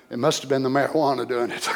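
usual speaking voice

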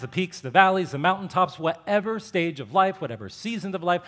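ordinary delivery

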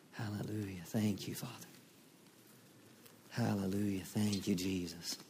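ordinary talk

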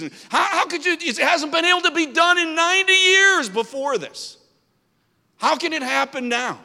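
high, loud, disbelieving challenge; incredulous doubters. Retold or imitated. Preacher voices critics doubting the school, loud and incredulous. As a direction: throw it out like a scoffing critic.

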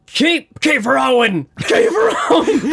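high, laughing shout to the crew; shouting orders. Acted. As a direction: yell it over the wind.

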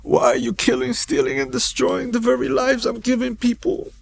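high, choked-up quoted voice; tearful divine voice. Retold or imitated. God confronting a drug dealer, voiced tearfully. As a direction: relay the voice, close to tears. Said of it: "And I hear this voice".

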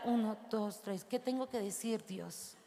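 soft, hushed counting to three; calming count. Retold or imitated. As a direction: count yourself down quietly before speaking. Counting to calm herself.

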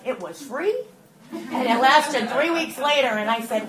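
usual speaking voice

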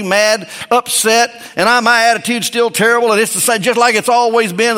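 high, loud pulpit preaching; preaching at full force. Acted. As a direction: drive it home to the congregation.

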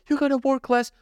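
high, mocking imitation of advisers; mocking advice. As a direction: parody unwanted advice in a high voice. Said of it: "When people are like".

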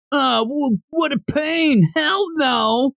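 high, drawn-out fed-up refusal; hammed-up reluctant whine; mock exasperation. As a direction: groan it out high, imitating her annoyance. Acts the woman refusing his request.

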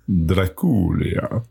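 mock vampire accent, slow and drawn out; hammed-up Transylvanian-style accent; film's accent. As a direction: imitate the film's exaggerated accent on Dracula. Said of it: "I like the way they say it".

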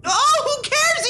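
high squeal of adoration; high, slow swoon; squealing delight. Real, not acted. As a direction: gush over the cute kitten. Swooning over cute cat.

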